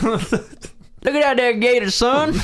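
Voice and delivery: high, slow cartoon Southern hillbilly; exaggerated high-pitched backwoods Southern twang; Southern accent. Shown as a demonstration. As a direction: ham up a Southern yokel accent. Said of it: "if I can do a Southern accent".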